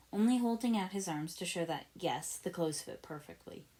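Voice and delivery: ordinary delivery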